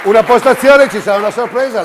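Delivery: loud, high, booming presenter; showy game show host. Acted. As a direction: hype up the crowd like a TV host. Host teases a surprise.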